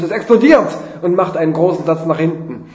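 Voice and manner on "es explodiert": loud, high alarmed call; Warnruf. A narrator's reading. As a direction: cry out a sudden warning. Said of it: "der Kerb ruft". (laut und hoch alarmiert gerufen)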